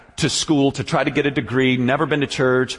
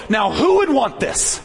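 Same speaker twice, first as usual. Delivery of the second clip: much higher, slow dramatic preacher climax; preacher's crescendo; 12 semitones higher than usual. Retold or imitated. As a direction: build to a big pulpit crescendo. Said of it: "his big crescendo".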